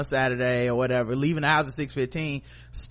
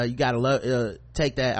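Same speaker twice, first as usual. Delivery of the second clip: usual speaking voice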